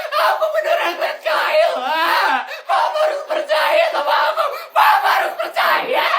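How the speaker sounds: very high crying shout; desperate plea. Acted. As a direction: beg through tears. Daughter begs father.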